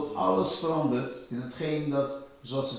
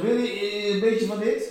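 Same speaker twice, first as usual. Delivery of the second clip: put-on stoned girl; 5 semitones higher, 4 dB louder than usual. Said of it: "Ze was helemaal stoned".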